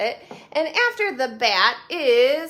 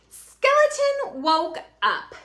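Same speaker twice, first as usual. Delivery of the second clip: put-on reading to children; 12 semitones higher, 5 dB louder, slower than usual. A narrator's reading. Reading picture book aloud.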